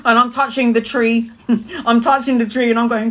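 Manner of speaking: normal talking voice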